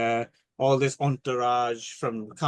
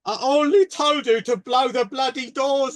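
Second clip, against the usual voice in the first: exaggerated Cockney Michael Caine, pitched way up; Michael Caine impression; 11 semitones higher, 5 dB louder than usual. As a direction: belt out the punchline as a hammed-up Michael Caine. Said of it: "And Michael Caine says".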